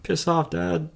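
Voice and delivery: put-on high, dismissive teen brush-off; mock sulky son. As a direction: toss it off like an annoyed teenage son. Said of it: "your son's gonna be like".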